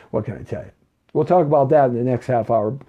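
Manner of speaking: ordinary talk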